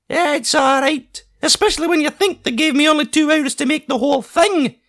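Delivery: high Scottish voice, aggrieved and grumbling; high Scottish painter sulking about his deadline; grumbling Scottish artist. A narrator's reading. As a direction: complain like an offended genius. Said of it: "He glared around the room".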